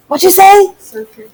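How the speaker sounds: ordinary talk, nothing marked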